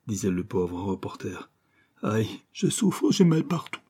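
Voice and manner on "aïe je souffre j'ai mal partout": high, slow wail of pain; pained moaning. Acted. Cramped reporter complains to his rescuer, pained wailing. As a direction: drag it out high, as if aching all over.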